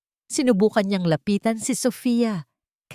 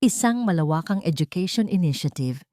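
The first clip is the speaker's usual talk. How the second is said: ordinary delivery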